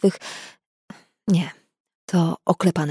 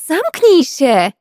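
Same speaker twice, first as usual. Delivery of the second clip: higher, louder, drawn-out shriek; shrieked shut up; 9 semitones higher, 6 dB louder, much slower than usual. Acted. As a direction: scream it at him, losing it. Yelling at teasing colleague.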